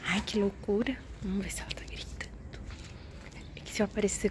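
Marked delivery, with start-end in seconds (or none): 1.2-3.7 whispered, sneaking a peek; whispering. Real, not acted. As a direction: hush it so the neighbour can't hear. Sneaking a look at neighbour.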